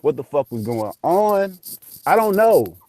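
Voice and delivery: re-enacted stunned disbelief; retold bewilderment. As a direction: pile up the questions, voice climbing and loud. Retold bewilderment after ghost revelation.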